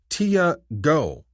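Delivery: high, syllable by syllable on Tia; alien woman, each syllable stressed. Acted. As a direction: teach it slowly and clearly, high up. Tia repeats her name.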